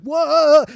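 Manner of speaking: high, loud "whoa" of alarm; startled yell. Retold or imitated. Rocky reacts to being grabbed and shaken, startled high yell. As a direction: imitate a man caught off guard by a shaking. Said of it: "he's looking at me like".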